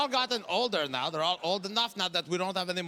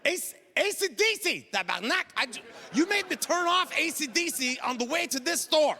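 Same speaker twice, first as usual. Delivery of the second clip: higher, indignant dad; outraged disbelief; 8 semitones higher than usual. Retold or imitated. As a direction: sputter in disbelief at the shirt.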